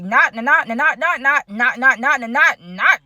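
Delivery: quick chanted "not, not" beats like blows landing; rhythmic mock punch sounds. Narrator mimics the blows Tasha landed on Sandy, rhythmic mock punches. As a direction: tap out each hit in a fast stuttered chant.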